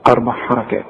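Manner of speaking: normal talking voice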